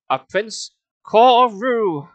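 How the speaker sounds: slow, loud, drawn-out doom voice; ominous villain name. Acted. Uncle names the villain's tomb, slow and ominous. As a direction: intone the name like a horror trailer.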